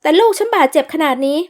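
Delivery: indignant protest. Acted. Mother protests again.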